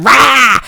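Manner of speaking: loud, high little-monster roar; mock roar. Voicing the little drop bear chasing him, a mock roar. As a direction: pounce with a growl. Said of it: "He's like".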